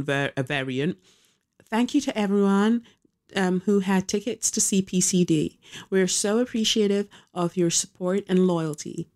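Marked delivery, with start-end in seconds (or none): 1.7-9.1 lightly voiced Nicole reading her statement; a hint of a put-on reading voice; mock-reading the celebrity's thank-you note. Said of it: "I don't even have to do her accent".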